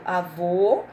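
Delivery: Avô with a closed, rounded final O; Avô ending on a closed O; closed final vowel. Shown as a demonstration. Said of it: "porque o som é fechado".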